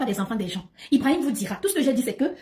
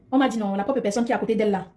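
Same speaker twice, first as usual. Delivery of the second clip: ordinary talk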